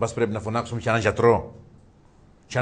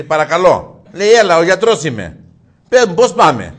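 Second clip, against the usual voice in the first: loud, higher phone voice; loud phone talk; 6 semitones higher, 14 dB louder than usual. Acted. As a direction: answer the phone loudly. Phone call answered loudly. (δυνατά στο τηλέφωνο)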